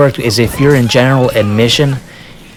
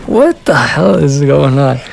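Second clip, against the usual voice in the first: baffled; 3 semitones higher, slower than usual. Retold or imitated. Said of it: "I'm like".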